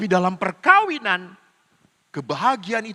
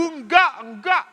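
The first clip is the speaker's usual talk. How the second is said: put-on high childish no; child refusing; 8 semitones higher than usual. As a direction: whine no like a small kid.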